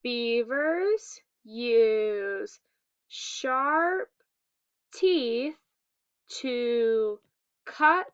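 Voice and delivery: one word at a time with long pauses; slow read-along. Shown as a demonstration. Teacher leading pupils to read along, very slow word by word. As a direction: stretch each word so learners can follow. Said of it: "read along with me".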